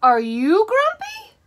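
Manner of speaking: high, slow curious question; Jim asking. A narrator's reading. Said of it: "asked Jim".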